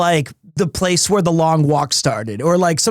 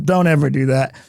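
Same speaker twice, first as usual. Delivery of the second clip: ordinary talk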